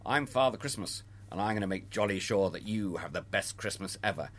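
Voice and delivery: soft, gentle comforting Father Christmas; a soft kindly Santa; reassuring Santa. Shown as a demonstration. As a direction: comfort the child softly. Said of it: "you might say".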